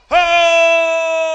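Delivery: huge high open shout; long loud ringing oh; shepherd's shout. Acted. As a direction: bellow across the flock at night.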